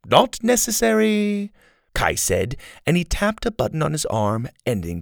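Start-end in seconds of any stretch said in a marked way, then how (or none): 0.0-1.5 higher, louder, cocky villain; villain mocking an official, higher; Kai, cocky. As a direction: sneer politely at the fat chancellor. Kai's voice.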